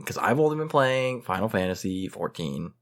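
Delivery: lightly put-on Valley Girl lilt. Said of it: "saying this in a Valley Girl voice".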